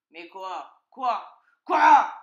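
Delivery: exasperated, building to a huge shout; fox yelling. A narrator's reading. Fox interrupted by stalling piglet, building to a yell. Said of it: "hurla le renard".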